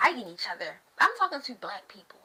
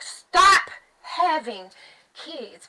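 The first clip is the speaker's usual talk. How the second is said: loud slow plea; 2 semitones higher, 4 dB louder, much slower than usual. Real, not acted. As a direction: shout the word stop slowly at all the parents. Ranting at parents to stop.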